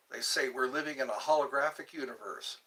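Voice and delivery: normal talking voice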